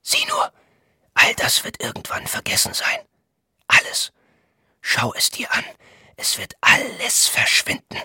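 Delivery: intense, voiceless stage whisper. A narrator's reading. Thilo warns Voynich as the waiter passes, whispering. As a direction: whisper it like an ominous secret. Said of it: "meinte Thilo flüsternd".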